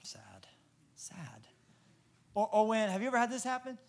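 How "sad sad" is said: whispered, mock-mournful; mock sad whisper. Comedian whispering his mock grief to the crowd. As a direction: breathe it out like a tiny tragedy. Joke after awkward wave story.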